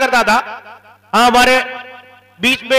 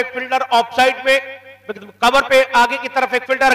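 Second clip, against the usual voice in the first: normal talking voice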